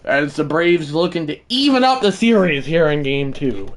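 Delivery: ordinary talk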